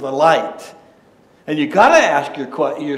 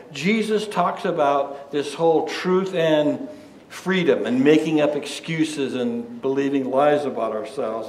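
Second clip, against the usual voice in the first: ordinary delivery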